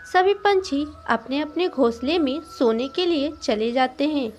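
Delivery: plain reading voice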